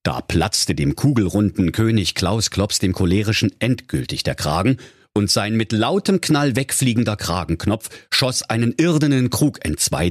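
plain reading voice

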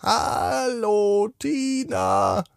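sehr hoch und sanft rufend; hohe Sternenstimme. Acted. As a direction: wie ein Stern aus der Ferne, hoch und leise. Stimme ruft erneut. (ein Stern ruft Tina sanft durchs Dachfenster, sehr hoch)

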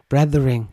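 Brethering with an English th; English-style Brethering. Shown as a demonstration. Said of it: "auf Englisch". (Brethering englisch ausgesprochen)

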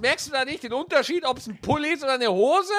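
incredulous outburst. Real, not acted. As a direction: pitch it way up in mock outrage. Incredulous rant at friend.

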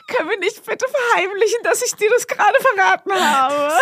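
high, wailing mock despair; hammed-up squeaky begging; panicked pleading. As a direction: plead as if about to cry. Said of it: "Und ich war so".

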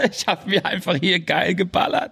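giggling through the words; laughing. Real, not acted. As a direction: laugh while talking. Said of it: "Speed gezogen".

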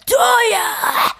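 imitating a stranger yelling her name, high and sharp; a mock shout from across the underpass; retold yell of her name. Stranger calling out her name, mock shout. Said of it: "jemand hat meinen Namen gebrüllt".

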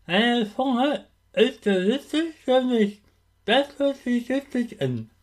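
words slurred by a held tongue; holding tongue with fingers. Shown as a demonstration. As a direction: talk while pinching your tongue. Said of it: "Halt mal deine Zunge mit zwei Fingern fest".

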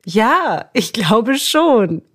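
slow, drawn-out reluctant agreement; hesitant yes. Retold or imitated. Client slowly admitting it works, drawn-out reluctant. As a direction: let it come out slowly, half convinced. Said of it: "hat sie gesagt".

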